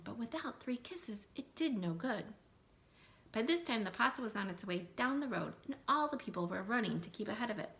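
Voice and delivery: ordinary delivery, nothing marked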